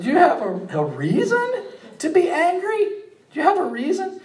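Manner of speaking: a kind God teasing a sulking prophet; merciful God. Acted. God gently asking sulking Jonah, high amused voice.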